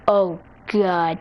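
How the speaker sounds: low, sinking dismay; dismayed realization. Acted. Girl seeing she got the gift wrong, dismayed realization. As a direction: let it drop low as dread sinks in.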